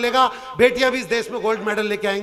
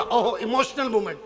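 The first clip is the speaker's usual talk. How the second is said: ordinary talk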